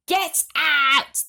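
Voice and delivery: loud, harsh yell. Acted. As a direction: throw him out. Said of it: "they yelled".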